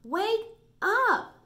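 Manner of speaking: loud, high call of wake up. Shown as a demonstration. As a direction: shout it out like Mom waking Dad. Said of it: "your voice gets really loud".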